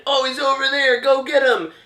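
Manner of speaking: high, eager call of a viewer; excited viewer shout. Pretend. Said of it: "someone who's watching can type in".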